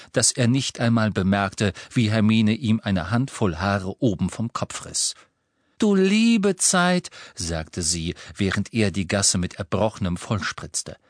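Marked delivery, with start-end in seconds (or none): 5.8-7.1 slow high drawn-out dismay. A narrator's reading. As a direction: gasp it out slowly, appalled. Feigned dismay at the vomiting.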